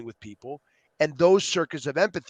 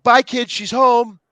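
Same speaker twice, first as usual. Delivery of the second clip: loud, high and slow, thrilled; excited goodbye; 8 semitones higher, 8 dB louder, slower than usual. Retold or imitated. Parent escaping kids as friend arrives, loud thrilled call. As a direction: call out gleefully as you rush off. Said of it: "You're like".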